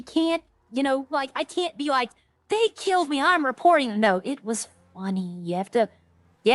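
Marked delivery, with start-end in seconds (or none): 2.5-4.0 higher-pitched mock grievance; mock complaint. Streamer mocking players who can't laugh it off, high indignant complaint. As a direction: play an indignant sore loser, pitched up.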